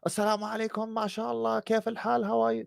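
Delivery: high, soft, overeager tourist greetings in Arabic; mock tourist voice; mock eager tourist. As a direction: parody a tourist showing off words. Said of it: "think you're impressing them".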